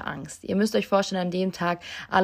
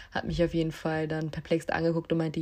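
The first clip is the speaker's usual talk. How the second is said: normal talking voice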